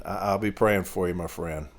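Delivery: tender comfort. Retold or imitated. Mail carrier promising prayers to a grieving customer, soft and tender. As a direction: soothe her gently.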